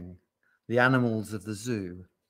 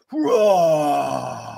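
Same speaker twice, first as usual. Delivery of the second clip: long, loud roar; gorilla roar; 4 semitones higher, 8 dB louder than usual. A narrator's reading. Gordon shows off to the girls, roaring. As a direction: roar like a chest-beating gorilla. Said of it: "and he roared".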